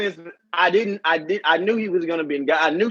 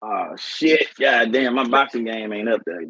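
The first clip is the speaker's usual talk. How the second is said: low embarrassed; 7 semitones lower than usual. Real, not acted.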